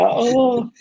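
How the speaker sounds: high, loud mock wail; imitated crying. Ex-player mimicking manager breaking down on his knees, mock crying. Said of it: "he started crying in front of me".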